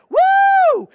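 very high, loud whoop; preacher mimicking a flashy donor, loud whoop; mock cheer. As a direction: whoop like a showy giver. Givers cheering at the stage.

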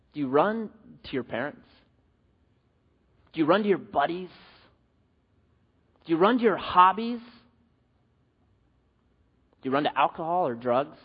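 ordinary talk, nothing marked

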